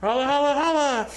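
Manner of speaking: high, loud sing-song holla; Teddy Long catchphrase. Retold or imitated. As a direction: belt out the catchphrase like a showman. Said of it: "Teddy Long was funny".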